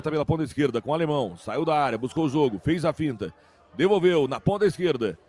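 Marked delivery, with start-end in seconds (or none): none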